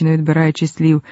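plain reading voice

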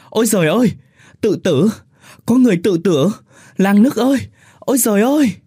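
very high, loud desperate cry; panicked wailing. Acted. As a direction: scream for the village to help. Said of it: "miệng gào lên". (gào thất thanh hoảng loạn)

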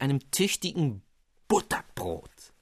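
usual speaking voice

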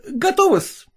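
high, dashing exclamation; exclaiming. Acted. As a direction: announce it with a dashing flourish. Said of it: "воскликнул он".

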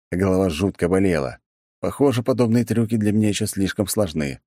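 plain reading voice

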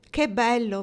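ordinary delivery, nothing marked

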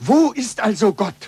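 urgent, pressing question; insistent demand. Acted. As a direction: press the point hard.

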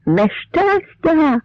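very high, drawn-out cry of alarm; frightened cry. A narrator's reading. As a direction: wail the disaster slowly, pitch way up. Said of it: "перелякано закричав дід".

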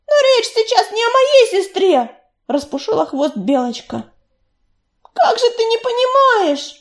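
put-on the young cat begging him to understand; desperate young she-cat. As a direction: plead with him, voice cracking. Белочка's desperate outburst.

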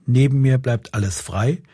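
usual speaking voice

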